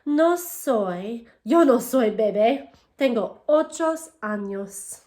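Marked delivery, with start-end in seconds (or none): none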